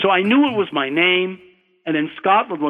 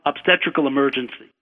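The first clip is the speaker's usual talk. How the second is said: usual speaking voice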